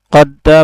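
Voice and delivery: কদ্দা with the d doubled and held; doubled consonant. Shown as a demonstration. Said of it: "সে হরফটি দুইবার করে উচ্চারণ হইতেছে". (তাজদিদে দাল দুইবার উচ্চারণ)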